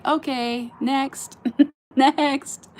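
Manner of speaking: lightly voiced judge dismissing an act, laughing; a playful hint of a game-show judge calling next; mock Gong Show judge. Joking about herself as a bad act, laughing mock judge. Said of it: "if I was on the Gong Show".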